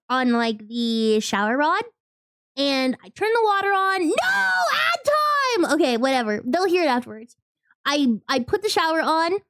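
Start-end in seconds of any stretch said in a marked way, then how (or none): 4.1-5.7 high, slow dismayed no; dismayed cry. Real, not acted. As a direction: wail at the ad break. Ad break interrupts story.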